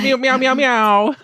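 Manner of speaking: high, loud meowing; cat meows. Pretend. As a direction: meow like a cat. Imitating cats.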